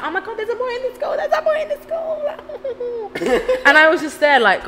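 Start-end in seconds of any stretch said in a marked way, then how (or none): 0.0-2.2 high, squealing giggly gush; mock-swoon like a giddy schoolgirl; girls gushing over a boy. Imitating classmates squealing about a boy in school. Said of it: "But we were like". 2.2-3.6 high giggle. Retold or imitated. Giggle after mocking schoolgirls.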